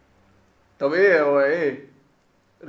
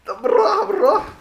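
high, louder begging; high pleading; 6 dB louder than usual. Real, not acted. Player begging the boss to go easy, high pleading. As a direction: plead with the enemy to ease off.

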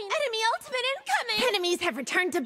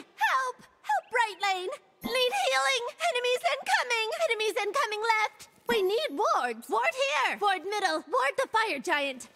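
ordinary talk

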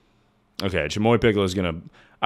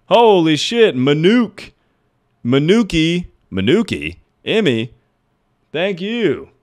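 louder, higher, thrilled greeting; excited shout-out; 7 semitones higher, 6 dB louder than usual. Real, not acted. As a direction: cheer the raider's name. Thanking a raider excitedly.

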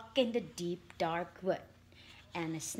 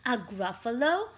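plain reading voice

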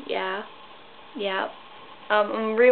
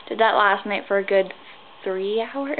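ordinary talk

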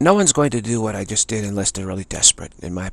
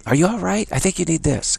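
higher, sugary mock concern from the ego; ego's coaxing voice; 8 semitones higher than usual. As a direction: coax like a false friend. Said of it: "It's like".

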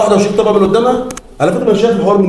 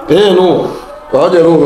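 usual speaking voice

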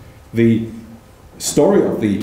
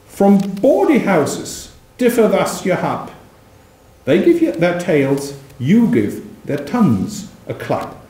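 poem reading; 4 semitones higher than usual. Acted. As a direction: roll out the insult like a pamphleteer. Reads final couplet.

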